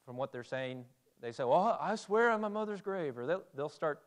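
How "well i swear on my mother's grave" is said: higher-pitched mock swearing; insistent oath. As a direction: imitate someone swearing up and down.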